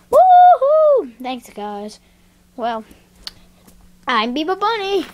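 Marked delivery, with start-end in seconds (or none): 0.1-1.1 very high, loud woo-hoo; long high-pitched held cheer; finishing whoop. Pretend. Whoop ending the song. 4.1-5.1 high, squeaky-pitched bunny introduction; high-pitched cartoon rabbit voice; bunny character voice.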